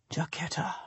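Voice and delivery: breathy whisper of her name, to himself. A narrator's reading. Duke whispers the girl's name to himself, hushed. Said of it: "He whispers my name".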